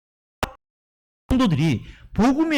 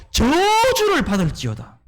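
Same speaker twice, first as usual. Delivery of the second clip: higher, slower, forceful pronouncement; a slow, raised condemnation; stern curse; 6 semitones higher, slower than usual. Retold or imitated. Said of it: "이렇게 강하게 말하고 있어요".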